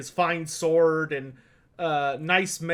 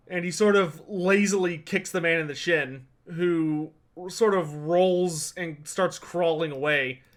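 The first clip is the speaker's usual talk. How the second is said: ordinary talk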